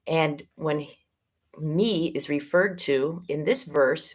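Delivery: ordinary delivery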